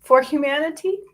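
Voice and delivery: fighting back tears as the pitch climbs; getting emotional. Real, not acted. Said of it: "I'm getting emotional".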